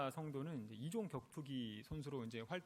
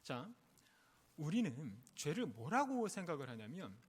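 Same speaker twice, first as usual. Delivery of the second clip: ordinary talk, nothing marked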